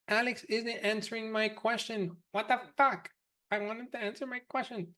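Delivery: high, whiny mock complaint; whiny student voice. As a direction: whine like an impatient student. Mocking students complaining to support.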